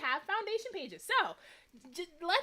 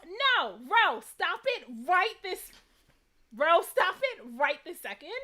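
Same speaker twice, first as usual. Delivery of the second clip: mock scolding; 4 semitones higher, 7 dB louder than usual. Real, not acted. Scolding viewer Ro teasingly.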